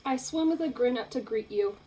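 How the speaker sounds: ordinary delivery, nothing marked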